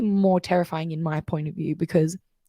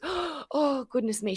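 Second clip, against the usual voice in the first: higher, softer, imitating a woman sobbing; a hammed-up tearful wail of a distraught wife; mock blubbering; 8 semitones higher, 7 dB quieter, slower than usual. Wife breaking down in public, imitated higher and softer blubbering. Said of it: "She starts blubbering and goes".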